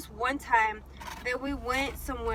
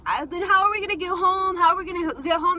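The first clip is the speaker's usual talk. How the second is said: higher-pitched mock panicky question; imitated upset worry; 6 semitones higher, 4 dB louder than usual. Crying friend asking how they get home, imitated worry. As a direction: plead lightly, voice raised, as a stranded friend. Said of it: "she starts crying and I'm crying".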